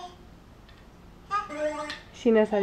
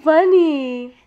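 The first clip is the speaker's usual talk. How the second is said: ordinary talk, nothing marked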